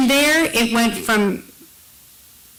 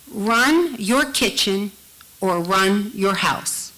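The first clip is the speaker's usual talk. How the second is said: slow, with weight on each run; a mother's slow, firm warning; slower than usual. Retold or imitated. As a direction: lay down the law, slowly.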